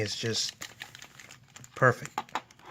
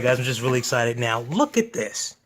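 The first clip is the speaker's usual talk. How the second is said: ordinary talk, nothing marked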